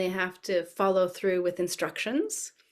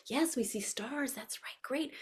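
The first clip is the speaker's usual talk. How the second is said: hushed praise for toddlers; softly delighted, drawn-out approval; animated teacher voice; 5 semitones higher, 9 dB quieter, slower than usual. Shown as a demonstration. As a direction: praise tiny children almost in a whisper. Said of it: "animated voice".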